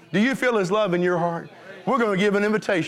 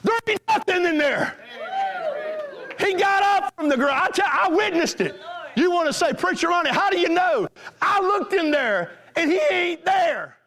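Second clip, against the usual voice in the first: full-force preaching. Acted. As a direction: preach it like a revival, shouting the proof.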